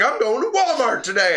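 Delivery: high, loud defiant announcement; retold anger. Telling the seller where they will shop instead, angry. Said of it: "I'm so pissed off".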